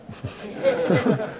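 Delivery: short high burst of laughter; amused laugh. Real, not acted. Telling the audience about New York drivers, amused laugh. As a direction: laugh at the absurdity.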